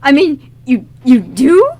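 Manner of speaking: can't get the words out, shocked; stunned stammer. Acted. Liam hears Abigail knows the singer, loud stunned stammer.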